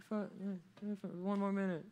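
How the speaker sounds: put-on high, soft drowsy kid; half-asleep stalling, small soft voice; sleepy mumbled reply. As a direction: plead quietly from under the covers. Sleepy child answers parent.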